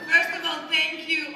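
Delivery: loud and excited, first word punched. Real, not acted. Nervous star opens her answer.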